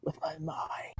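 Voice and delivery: breathy spooky whisper of mind power; mysterious whisper. Pretend. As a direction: whisper it like a mystic. Mock mystical whisper.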